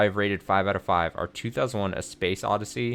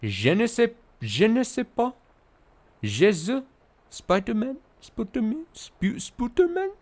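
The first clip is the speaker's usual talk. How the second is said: put-on climbing ever higher, silly French accent; mock French accent; 13 semitones higher than usual. Streamer escalating a silly French impression for chat. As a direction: push the French voice higher each time. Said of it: "my impersonation of the French accent".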